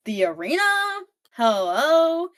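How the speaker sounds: high, loud disbelief; incredulous outrage. Retold or imitated. Evie hears Tristan goes to arena, loud high disbelief. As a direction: throw it back at him, appalled.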